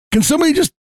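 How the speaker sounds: hammed-up helpless plea; mock desperate plea. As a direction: beg to be rescued, half joking. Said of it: "and then I'm like".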